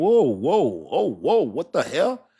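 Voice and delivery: rising, startled whoa and what the helly; shocked exclamation. Retold or imitated. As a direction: act floored by what you just found out. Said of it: "You're like".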